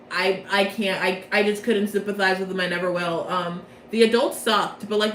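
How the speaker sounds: normal talking voice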